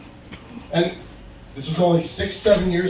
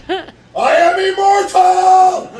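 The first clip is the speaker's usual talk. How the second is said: huge, high, drawn-out triumphant scream; 14 semitones higher, 7 dB louder, much slower than usual. Acted. Comic declares himself immortal on stage, screaming it. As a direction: scream it slowly to the rafters. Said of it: "I wanted to scream that on stage".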